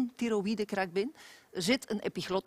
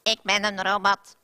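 robot voice; 6 dB louder than usual. Shown as a demonstration. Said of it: "Dat is twang".

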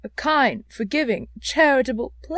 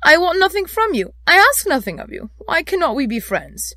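high, loud, heartfelt appeal; urgent plea for friendship; pleading; 10 semitones higher, 10 dB louder than usual. A narrator's reading. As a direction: implore him earnestly.

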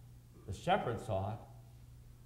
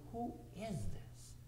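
hushed awe; 6 semitones higher, 10 dB quieter, slower than usual. Acted. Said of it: "say simply".

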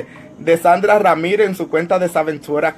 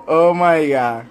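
normal talking voice

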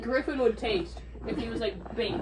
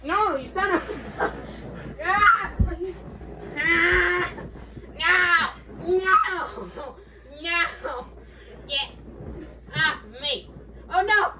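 panicked yelling; 4 semitones higher, much slower than usual. Real, not acted. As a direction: yell while pinned down. Pinned in a wrestling hold.

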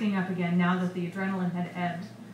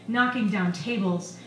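plain reading voice

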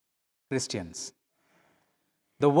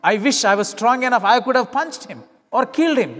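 ordinary talk

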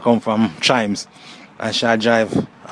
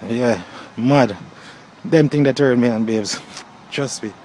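ordinary delivery

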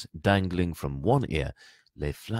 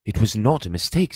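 raised, loud retort; Harry angry; 4 semitones higher, 8 dB louder than usual. A narrator's reading. Boy disputing his uncle's excuse, angry. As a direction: contradict firmly and crossly. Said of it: "said Harry angrily".

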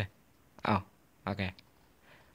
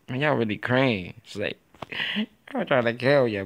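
ordinary talk, nothing marked

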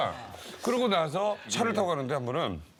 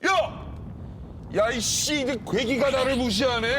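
imitated high, loud angry yell; angry outburst; 9 semitones higher, 4 dB louder, slower than usual. Retelling senior's rage at a fish, angry yelling. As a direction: blow up in mock outrage. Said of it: "네가 무슨 화낸 적이 없다고".

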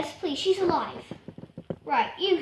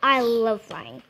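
ordinary delivery

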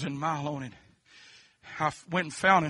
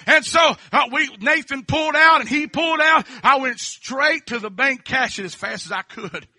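shouted, climbing preacher's cry; full-force revival preaching, rising to a sung cry; 6 semitones higher, 9 dB louder than usual. Real, not acted. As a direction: build to a soaring, half-sung shout. Preacher climaxes testimony.

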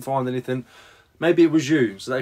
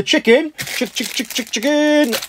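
chicken call; 10 semitones higher, 5 dB louder, slower than usual. Pretend. Draw for the chicken prize.

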